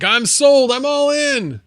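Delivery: high, loud, over-the-top hype; mock excited shout. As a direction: shout it like a giddy buyer, hammed up. Mock hype reply to chat.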